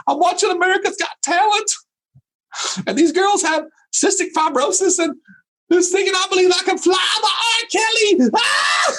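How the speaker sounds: high-pitched, hammed-up blubbering; mock bawling. Dad tearfully telling his wife about a TV talent show, mock bawling. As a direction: wail through it in a fake crying fit. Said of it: "I am bawling".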